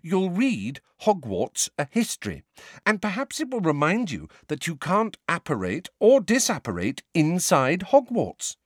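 mild exasperation; exasperated. A narrator's reading. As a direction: spell it out as if for the hundredth time. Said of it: "sounding thoroughly exasperated".

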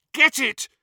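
short, high, loud yell. A narrator's reading. Harry fighting the pain of hot metal, high urgent yell. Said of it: "Harry yelled".

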